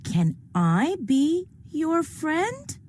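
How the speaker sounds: high, gentle pigeon; a kind pigeon offering friendship. Acted. Pigeon asks crying baby.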